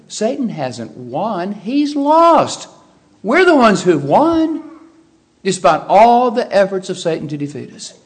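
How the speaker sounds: slow, building to a high, loud cry of victory; triumphant pulpit climax; rising proclamation. Real, not acted. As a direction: build it up until you ring out the win. Preacher proclaiming victory over Satan.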